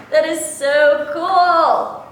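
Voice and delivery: high, loud, very drawn-out delight; thrilled. Real, not acted. Unboxer admires the mermaid top, thrilled. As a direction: stretch the words out in awe.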